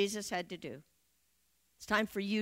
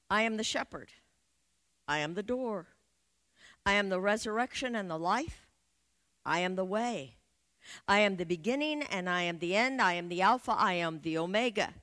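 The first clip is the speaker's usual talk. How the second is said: scripture recitation; 4 dB louder than usual. Acted. Reciting Jesus' I am sayings.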